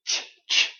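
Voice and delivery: "Ch, ch." whispered, voiceless bursts; ch sound. Shown as a demonstration. Teacher demonstrating the voiceless ch sound. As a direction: puff out the ch with no voice. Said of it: "What about this?".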